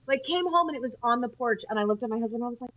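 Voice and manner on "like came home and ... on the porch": normal talking voice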